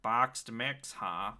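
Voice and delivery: sneering mom voice; dry, unimpressed drawl; snide woman voice. Acted. Mary voiced in play.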